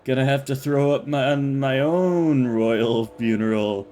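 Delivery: put-on slow, loud slurring drunk. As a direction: play a sloppy drunk, slowly. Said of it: "That was a pretty shitty drunk voice".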